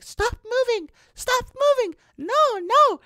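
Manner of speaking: frantic falsetto imitation of a girl; mock high-pitched drunk girl. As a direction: mimic her very high, insistent pleading. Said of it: "with her, like, high-pitched voice".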